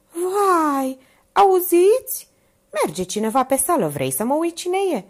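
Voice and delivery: high, loud alarmed cry; high frightened exclamation; startled fear. A narrator's reading. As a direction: jump at the sound in the corridor. Minerva startled by footsteps.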